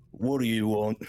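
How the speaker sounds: put-on orc voice; battle-weary orc character; orc warrior. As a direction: answer as an orc called over by a guard.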